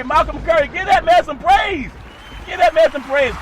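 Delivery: proud high, loud holler; shouted praise. Real, not acted. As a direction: cheer his name like a hype man. Shouting praise for his son.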